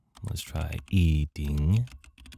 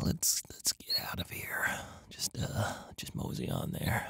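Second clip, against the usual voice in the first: whisper; 2 semitones higher, 13 dB quieter than usual. Pretend. Sneaking away after killing witch.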